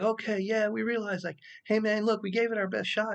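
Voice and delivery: high-pitched mock voice of classmates; mocking other students. Mimicking classmates brushing off his point, high and mocking.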